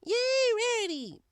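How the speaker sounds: high bright cheer; sudden high cheer. Acted. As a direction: cheer her on. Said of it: "Pinks cheered".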